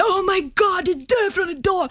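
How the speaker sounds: high, thrilled fan calling out; excited fan shout. Retold or imitated. Scooter guy spotting the Idol judge, high excited call. Stranger on scooter recognizes her.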